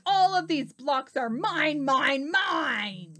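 storybook dinosaur shouting ownership; greedy shout. A narrator's reading. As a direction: bellow possessively, stretching the end. Minosaur claims the blocks.

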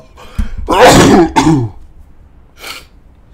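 loud throat-clearing cough; real coughing fit. Real, not acted. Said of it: "sorry about that".

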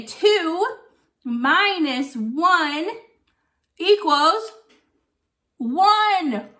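drawn-out minus one; slow counting, then up on the answer; slow teaching count. Shown as a demonstration. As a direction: spell the sum out slowly for kids. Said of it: "teaches a subtraction sum".

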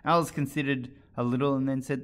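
ordinary delivery, nothing marked

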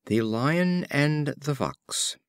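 slow, measured title announcement; title read. A narrator's reading. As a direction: set the title apart, slowly. Reads fable titles slowly.

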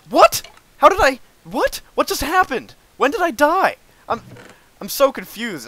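high, loud disbelieving cries; shocked outburst. Real, not acted. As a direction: blurt it out in total shock. Sudden death, shocked outburst.

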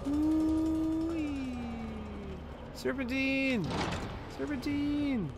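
high, loud yells of serpentine; excited yelling. Real, not acted. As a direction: whoop it up while dodging. Running, yelling zigzag joke.

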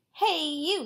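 brash storybook heckle; acted calling out. A narrator's reading. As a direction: holler at a stranger for a fight. Grouchy ladybug accosts a skunk.

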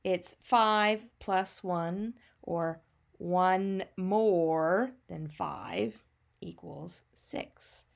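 slow, deliberate math sentence; slow teacher explaining. Shown as a demonstration. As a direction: spell it out slowly for children. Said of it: "If you want to do that in a math sentence".

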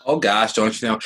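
a hammed-up Minnesotan "oh gosh"; Minnesotan accent. Co-host echoes the joke in a mock Minnesotan accent. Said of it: "the Minnesotan accent".

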